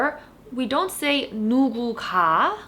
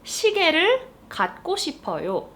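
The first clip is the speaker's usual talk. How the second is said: fast, higher Korean sentence; fast example; 8 semitones higher, much faster than usual. Shown as a demonstration. Teacher reading the example sentence quickly. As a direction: rattle it off. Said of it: "let's look at this sentence".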